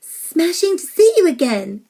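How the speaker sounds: put-on high, loud, swooping old lady; cheerful. A narrator's reading. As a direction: greet him with hearty cheer. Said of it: "said Nellie cheerfully".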